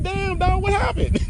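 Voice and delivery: very high startled imitation through laughs; laughing mock shock. Replying to offended friend, high laughing mock shock. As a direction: squeal the stunned reply while cracking up.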